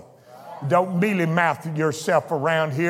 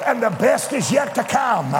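preaching at full force; 7 semitones higher than usual. Acted. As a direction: rail against the devil in triumph.

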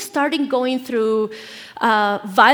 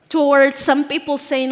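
usual speaking voice

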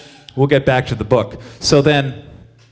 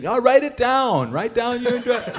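higher put-on voice of the singer; 14 semitones higher, slower than usual. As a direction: rattle off the keg invite in his voice. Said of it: "And [name]'s like".

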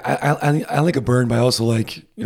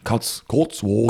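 put-on German accent; much slower than usual. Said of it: "saying it in this German accent".

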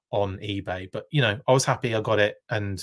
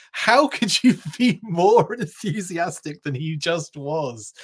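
laughing disbelief; 6 semitones higher, slower than usual. Retold or imitated. Laughing at the retake request.

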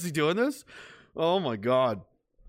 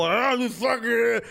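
put-on drunk yelling; 6 semitones higher, slower than usual. Drunk Yeltsin yelling at people.

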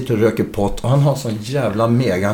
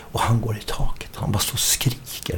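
whispered; 7 dB quieter than usual. Real, not acted. Retelling a wild reaction.

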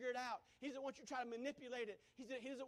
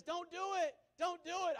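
higher, louder tempting voice; the flesh pleading loudly; 6 semitones higher, 9 dB louder than usual. Acted. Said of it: "Your flesh says".

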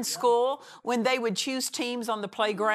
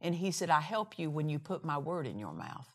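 softer, intimate retelling of talking with God; hushed inner dialogue; 3 semitones lower, 7 dB quieter than usual. Retold or imitated. Widow retelling God's answer to the church, softer and hushed. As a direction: draw the listeners in, softly. Retold quiet exchange with God.

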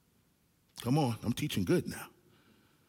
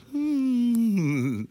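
put-on fussy child; 11 semitones higher, 3 dB louder than usual. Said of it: "they're like".

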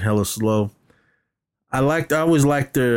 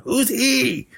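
mock high surprise. Retold theater reaction.

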